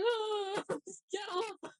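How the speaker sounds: high, thin mock wail; high-pitched, half-crying protest, played for laughs; retold panicked plea. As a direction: squeal it as a scared teenager retelling the moment. Retelling fear of costumed rabbit.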